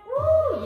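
high loud cheering woo; excited whoop. Real, not acted. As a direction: let out a big cheer. Cheering during ab exercise.

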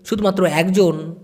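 normal talking voice

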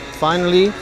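ordinary talk

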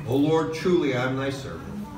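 usual speaking voice

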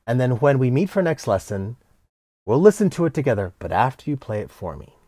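usual speaking voice

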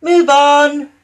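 high, loud toot-like call. Acted. Daisy tooting at the bull to move. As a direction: hoot it out like a horn. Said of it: "tooted Daisy".

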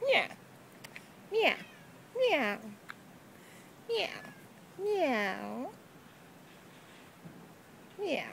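short playful meows; meowing back. Pretend. Owner repeating the cat's sound back to her. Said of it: "you repeat the sounds that they make".

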